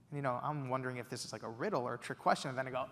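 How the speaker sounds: ordinary delivery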